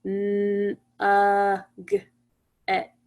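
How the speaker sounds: nugget segmented as N, uh, g, et, each sound separate. Shown as a demonstration. Teacher helping class count sounds, each sound isolated. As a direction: sound out N, uh, g, et on a level pitch with gaps. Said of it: "Let's think about the sounds".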